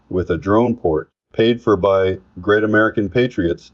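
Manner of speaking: ordinary delivery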